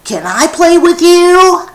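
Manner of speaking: put-on high, loud childlike plea; a high voiced little boy begging to play; eager child asking. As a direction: ask to join in like an eager kid. Sammy asks to play.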